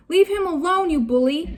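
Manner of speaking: high indignant cry at the bully; angry cry. A narrator's reading. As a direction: scold the pirate fiercely. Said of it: "she cried".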